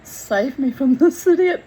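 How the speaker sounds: lightly voiced pleading dog; dog's plea. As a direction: voice the dog begging rescue. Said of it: "dog looking up like".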